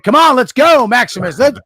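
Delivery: loud, high coach's shout; a dad imitating a yelling basketball coach. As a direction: bark it like a drill coach. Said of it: "he's yelling and screaming".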